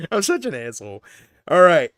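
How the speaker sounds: usual speaking voice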